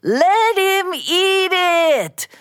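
slow, high, gloating jeer; taunting. Acted. Mister Bao taunting his rival, gloating. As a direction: drag out the taunt. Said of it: "Mister Bao taunted".

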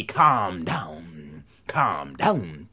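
very low, soft command; low cord voice. A narrator's reading. Whipping cord telling son the magic words, low and soft. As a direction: intone it deep and quietly.

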